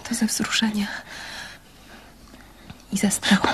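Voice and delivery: trembling whisper, scared and moved; whispered confession of fear. Acted. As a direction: breathe it out, barely voiced. Frightened woman answers softly.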